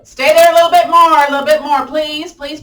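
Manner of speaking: loud, high coach's plea; playful pleading through the effort; urgent pleading. Real, not acted. Urging class to hold the pose.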